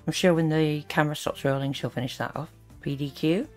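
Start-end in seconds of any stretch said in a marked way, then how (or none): none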